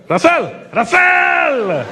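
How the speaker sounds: put-on high drawn-out shout in an Indian accent; emphatic fan call. Indian fan spots the comedian on the street, high emphatic shout. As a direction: call out the name high, stretching the second one. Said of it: "They were so emphatic".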